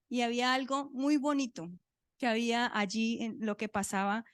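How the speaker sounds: ordinary talk, nothing marked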